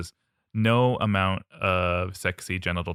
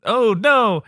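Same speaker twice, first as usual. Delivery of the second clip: high squeal; 9 dB louder than usual. Pretend. Bird squeals and leaves.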